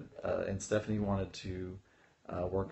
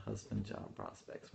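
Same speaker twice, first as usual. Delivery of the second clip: slow, softer whisper while writing; whispered note to self; 11 dB quieter than usual. Real, not acted. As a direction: whisper it as you jot it down. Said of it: "so I'm writing this down".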